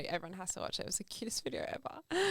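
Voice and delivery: gushing "It was the cutest video ever." in an unvoiced whisper. Real, not acted. Friend whispering how cute the granny video was. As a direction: breathe it out like a secret.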